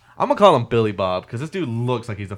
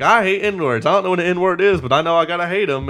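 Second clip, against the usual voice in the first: higher, louder mock bigot; mocking Edward Norton; 6 semitones higher, 5 dB louder than usual. Inmate teasing his cellmate with a joke, mocking imitation. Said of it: "he's mocking Edward Norton".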